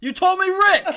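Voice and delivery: high, loud accusing shout; a loud, high-pitched mock accusation; laughing outcry. Real, not acted. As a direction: yell it at your partner through a laugh. Accusing co-host over wrong wrestler.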